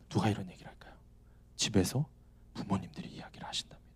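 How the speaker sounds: hushed aside. Real, not acted. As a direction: drop to a near whisper. Confiding aside to congregation.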